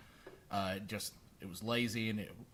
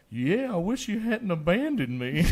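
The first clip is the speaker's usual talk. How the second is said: very high, slow, louder talking-cat voice; voicing the cat Brutus; 7 semitones higher, 6 dB louder than usual. Brother pretending to be the cat calling his sister, high and slow. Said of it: "I was like".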